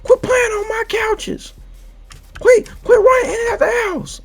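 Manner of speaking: high, strained mock-angry grandpa rant; hammed-up cranky old man scolding the kids; grumpy old grandpa. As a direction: holler like a grumpy grandpa, mad for no reason. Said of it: "I might be an old, grumpy".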